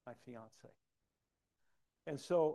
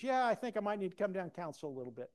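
fast, high, louder retold reply; imitated concerned friend; 6 semitones higher, 6 dB louder, faster than usual. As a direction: mimic a friend hurriedly inviting himself. Imitates friend wanting to intervene.

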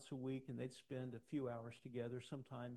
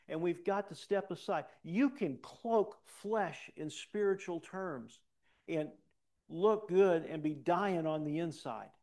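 loud, raised preacher's exhortation; forceful preaching; 6 semitones higher, 9 dB louder than usual. Real, not acted. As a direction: press the congregation hard, voice lifted high.

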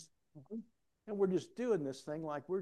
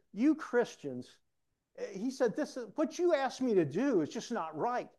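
higher, louder imitation of a protesting boss; mock exasperation; 9 semitones higher, 5 dB louder than usual. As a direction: play a supervisor's indignant complaint. Supervisor's complaint acted out.

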